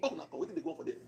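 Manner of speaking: hushed whisper, voice dropped right away; whispered, startled aside; whispered mock alarm. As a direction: breathe it out like a shocked secret. President reacting to chaos.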